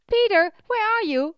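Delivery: loud, high shout from outside; calling out. A narrator's reading. Innkeeper calls from outside for Peter in the stable, calling out loudly. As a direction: call out to someone a distance away. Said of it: "called from outside".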